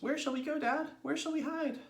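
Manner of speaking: put-on very high, eager child voice; little ones' voices. A narrator's reading. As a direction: ask dad like excited youngsters. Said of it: "cried the two little ones".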